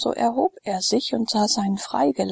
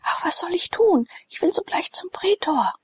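much higher, breathless with worry; frantic and desperate; 10 semitones higher than usual. Acted. Sallust to his freedman, desperate to save Glaukus, frantic. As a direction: panic at the thought he is too late.

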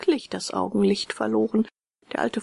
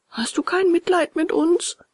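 high, pleading whine; howling beggar's plea; wailing fox; 10 semitones higher, 3 dB louder than usual. A narrator's reading. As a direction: beg for pity in a raised wail. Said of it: "So heulten die zwei Räuber".

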